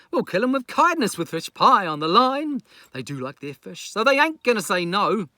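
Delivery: captain plotting to let crabs clean; Festus, scheming. Acted. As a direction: hatch the lazy plan with relish. Festus scheming plan, voiced.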